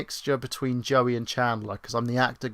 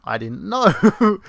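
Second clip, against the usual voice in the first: high, loud, slow through laughter; laughing; 7 semitones higher, 9 dB louder, much slower than usual. Real, not acted. Streamer laughing at his own flaw, high laugh. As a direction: squeal it out in a laugh. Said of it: "apparently that's very patronizing".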